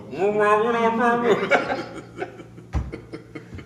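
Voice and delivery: high, wordless mumbling as if tongueless; mock speech with no tongue, no real words; tongueless garbled wail. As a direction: garble it like your tongue was cut out. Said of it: "His tongue was gone".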